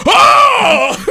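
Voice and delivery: high loud cry of fright; scared shout. Acted. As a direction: shriek in fear. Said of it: "they go".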